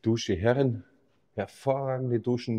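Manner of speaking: ordinary talk, nothing marked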